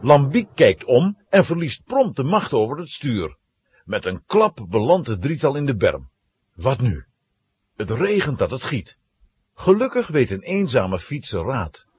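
ordinary delivery

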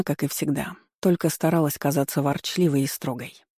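ordinary delivery

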